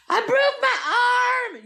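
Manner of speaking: high, drawn-out hammed-up scream; screaming in panic. Woman waking to a stuck arm, acting out screaming. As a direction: belt it out as a panicked shriek. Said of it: "I'm fucking screaming in the bedroom".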